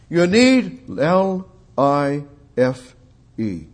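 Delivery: slow letter-by-letter spelling of L I F E; spelled out slowly. Shown as a demonstration. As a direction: spell L I F E out, each letter apart.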